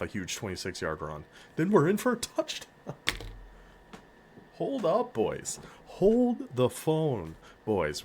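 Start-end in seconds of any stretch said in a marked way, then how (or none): 1.6-2.7 high, loud thrilled touchdown call; excited touchdown call. Real, not acted. Reacting to surprise touchdown in game. 4.6-8.0 stretched, high-pitched astonished hype; amazed excitement. Real, not acted. Amazed reaction to team scoring.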